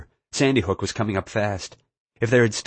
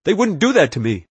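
higher-pitched insistent denial; defensive, raised-pitch protest; warning; 6 semitones higher, 4 dB louder than usual. A narrator's reading. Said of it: "Murray warned".

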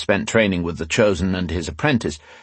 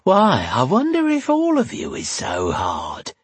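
slow, high, suggestive lilt; drawn-out, high-pitched seduction; flirty tavern woman, breathed; 11 semitones higher, slower than usual. A narrator's reading. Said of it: "she breathed".

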